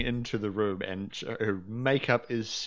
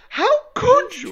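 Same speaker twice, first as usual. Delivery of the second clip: much higher, much louder shrill wail; shrill scream; 10 dB louder than usual. Acted. Noblewoman bursts in on the king, shrill shriek. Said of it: "you hear a shrill screaming".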